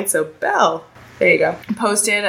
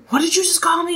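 high-pitched imitation of shocked offence; hammed-up affronted question; mock offence; 12 semitones higher, faster than usual. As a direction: act scandalised, voice shooting up. Acts offended people.

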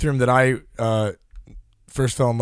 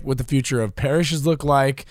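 ordinary delivery

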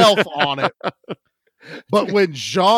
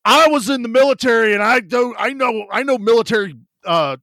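louder, higher blustering confidence; mock bold claim; 4 semitones higher, 6 dB louder than usual. Old man bluffing his medical skill, loud and higher. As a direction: puff up like a proud old vet. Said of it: "He goes".

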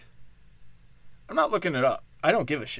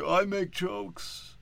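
slow, higher, drawn-out mock voice; I make jokes stretched out slowly and pitched up; mock unfunny comic; 7 semitones higher, 4 dB quieter, much slower than usual. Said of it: "I don't need to see".

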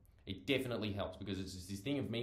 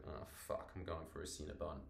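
low, soft mock-defeated grumble; resigned giving in; 5 semitones lower, 11 dB quieter than usual. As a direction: sigh it out as guilty surrender. Acts glum person giving in.

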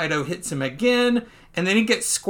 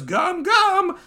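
high, excited hero call; attack call; 13 semitones higher than usual. Acted. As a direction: call out the move, high-pitched. Acting Luffy's attack call.

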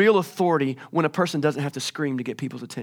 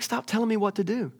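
higher pitched, held-back mock exasperation; mild exasperation; 6 semitones higher, 3 dB quieter than usual. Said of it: "He's like".

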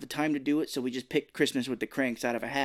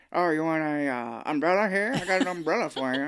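drawling character voice, half laughing; mock old Santa voice; M. Emmett Walsh; 4 semitones higher, 3 dB louder than usual. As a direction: slur it like a shady old street Santa. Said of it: "kinda sounds like him".

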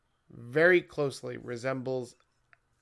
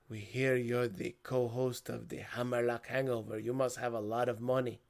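Spanish voice; 3 semitones lower, 3 dB quieter than usual. Acted. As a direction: imitate a Colombian stranger with a heavy accent. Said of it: "when I do that Spanish voice".

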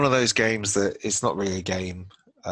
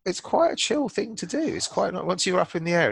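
high excited gush; 12 semitones higher, 5 dB louder than usual. Real, not acted. Enthusing about the sim.

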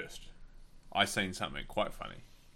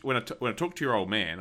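ordinary delivery

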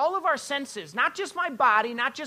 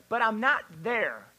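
ordinary delivery

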